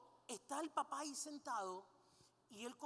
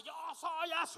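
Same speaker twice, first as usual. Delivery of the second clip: loud, high shouted roar; beastly outburst; 5 semitones higher, 7 dB louder than usual. Acted. Men who can't control the beast, roaring defiantly. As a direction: burst out like a beast let loose. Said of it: "no controlan esa bestia".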